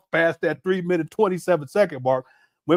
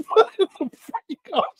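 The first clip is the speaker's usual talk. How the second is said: mock shock; 9 semitones higher than usual. Retold shocked reaction.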